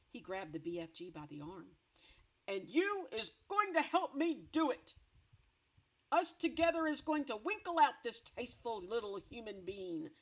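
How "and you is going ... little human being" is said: higher, louder commanding shout; giant shout. Acted. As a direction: order him gruffly. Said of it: "he shouted".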